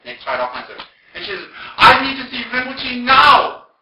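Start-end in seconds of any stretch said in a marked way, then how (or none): 1.8-3.7 high, drawn-out shouted demand; screaming indignantly. Retold or imitated. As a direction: imitate her screaming a demand. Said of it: "she was screaming at me".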